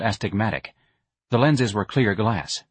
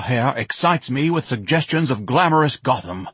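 pompous lovesick Ignatius; 3 semitones higher than usual. Acted. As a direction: ham it up like a grandiose suitor. Ignatius woos Myrna.

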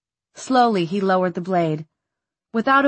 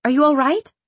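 anxious; 7 semitones higher, 4 dB louder than usual. A narrator's reading.

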